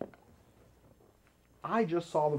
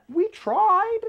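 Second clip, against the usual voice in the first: much higher, louder plaintive squeak; mock whine of defeat; whiny protest; 7 dB louder than usual. Answering viewers about failed green screen, whiny protest.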